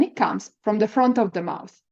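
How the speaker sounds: normal talking voice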